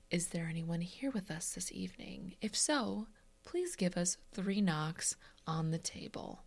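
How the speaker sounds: hushed spooky seance whisper; medium's whisper. Acted. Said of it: "You know, the".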